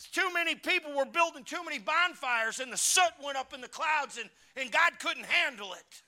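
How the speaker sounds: loud, high mocking sermon; preacher scoffing at full volume; full-force preaching. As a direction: ridicule the excuse from the pulpit.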